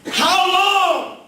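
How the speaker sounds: impassioned preacher's wail; preaching at full force. Acted. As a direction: cry the psalm up to heaven. Preaching psalm lament at full force.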